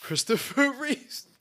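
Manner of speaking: laughing through the name. Real, not acted. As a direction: breaks into a laugh mid-name. Joke name pun.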